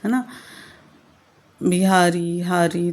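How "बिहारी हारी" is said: बिहारी हारी said slowly, each rhyme ending leaned on; rhyme words stressed slowly. Shown as a demonstration. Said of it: "तो ये rhyme scheme होती है". (बिहारी हारी धीरे और ज़ोर देकर, तुक दिखाते हुए)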